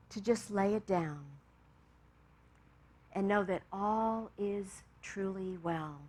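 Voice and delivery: hushed meditation voice drawing to an amen; guided meditation. Acted. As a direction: ease them gently back into the room. Closing meditation and prayer.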